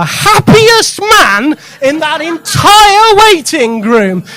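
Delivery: put-on loud, high, slow drunken glee; happy drunk. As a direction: ham up a blissfully drunk man. Drunk man acted in story.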